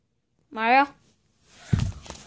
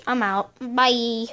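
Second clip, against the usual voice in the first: usual speaking voice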